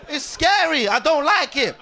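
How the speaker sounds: ordinary delivery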